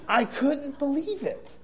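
high, drawn-out incredulity; exasperated disbelief. Retold or imitated. Preacher telling congregation about the hypocrite, exasperated disbelief. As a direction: stretch it out high in comic disbelief. Reacting to the hypocrite's book title.

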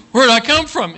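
put-on high, loud little-boy wail; panicked child. Little boy hearing he must go back, panicked cry. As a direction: blurt it in mock alarm.

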